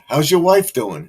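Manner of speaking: teasing friend putting on a cop act; playful cop voice. Retold or imitated. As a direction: boom it like a cop pulling you over. Cop friend teasing at the car window.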